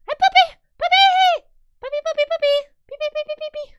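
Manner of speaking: very high, loud, slowed puppy-calling voice; high-pitched doggy talk. Real, not acted.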